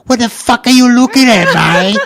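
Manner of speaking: hammed-up blonde girl telling him off; aggressive Aussie girl. Imitating the blonde in the pub snarling at the staring newcomer.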